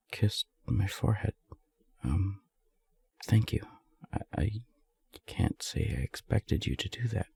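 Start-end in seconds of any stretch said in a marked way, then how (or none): none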